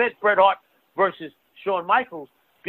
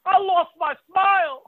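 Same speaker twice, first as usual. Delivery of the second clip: high, slow mocking voice; mock wrestler quote; 9 semitones higher, slower than usual. Joking with a co-host about a wrestler, high mocking quote. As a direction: draw it out high in a put-on voice.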